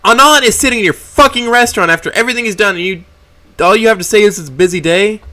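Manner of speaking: raging at the boss who won't care; furious rant. Acted. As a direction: let the rage leak out.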